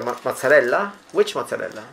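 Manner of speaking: ordinary talk, nothing marked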